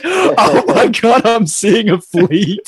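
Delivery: high, drawn-out mock amazement; retold awe. Telling friends he dropped to his knees, hammed-up high awe. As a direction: act out awestruck wonder, slowly.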